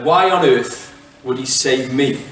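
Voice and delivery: normal talking voice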